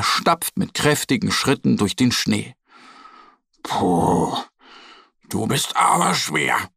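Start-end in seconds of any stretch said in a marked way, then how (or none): 3.6-6.7 gepresst stöhnend, das Puh tonlos und tief; ächzen wie ein müder kleiner Bär. A narrator's reading. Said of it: "stöhnt der kleine Bär". (stöhnt unter der schweren Last)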